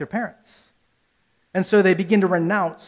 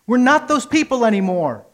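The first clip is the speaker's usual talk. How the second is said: rousing declaration; 6 semitones higher, 5 dB louder than usual. Retold or imitated. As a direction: proclaim it to the whole congregation. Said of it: "and we say".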